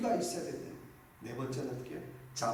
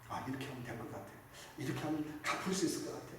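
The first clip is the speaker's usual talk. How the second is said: softer, musing planning to himself; hushed inner planning; quiet self-talk; 4 semitones lower, 8 dB quieter, faster than usual. Retold or imitated. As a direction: think it through under your breath. Voicing his own planning.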